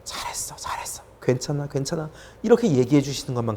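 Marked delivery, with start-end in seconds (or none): 0.0-2.1 hushed whisper easing into soft voice; whispered, soothing praise; tender reassurance. Retold or imitated. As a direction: comfort like God holding someone close. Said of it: "꽉 안아주시면서".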